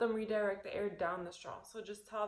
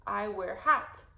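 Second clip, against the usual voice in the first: phrase practice; 8 dB louder, much slower than usual. Shown as a demonstration. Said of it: "like we can say".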